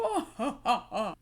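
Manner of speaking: laughing, hammed-up evil cackle; a cartoon villain's cackle; mock maniacal cackle. Imitating Voldemort's evil laugh, a mock cackle. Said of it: "a maniacal".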